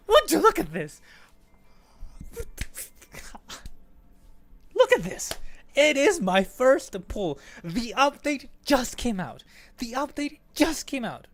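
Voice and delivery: high, loud, crowing delight; excited. Real, not acted. Gamer showing off a first pull on the new update, excited. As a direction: crow loudly over your luck.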